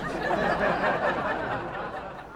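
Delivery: rising scornful laugh; mocking laugh. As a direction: laugh at the old-fashioned sword.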